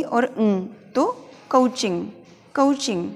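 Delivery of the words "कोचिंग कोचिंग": कोचिंग with a gliding "uh-oo" vowel; कोचिंग with English O diphthong; improved pronunciation. Shown as a demonstration. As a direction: say कोचिंग as "kuh-oo-ching". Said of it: "हमें इसे बोलना है".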